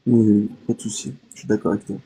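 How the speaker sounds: normal talking voice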